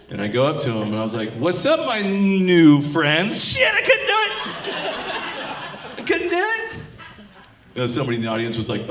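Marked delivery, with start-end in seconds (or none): 1.4-3.4 slow, loud greeting stretched on "new"; teasing drawn-out greeting. Acted. Building to word, swerving away. 3.4-6.7 very high, squealed admission of failure; mock panic. Backing out of forbidden word.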